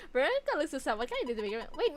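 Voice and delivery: usual speaking voice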